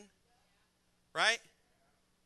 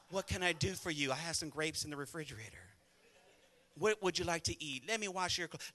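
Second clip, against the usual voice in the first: soft, lower-voiced imitation of a doting wife; attentive wife; 3 semitones lower, 7 dB quieter than usual. Wife offering husband food and laundry, softly attentive. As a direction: gently offer to serve, as the wife. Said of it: "She's like".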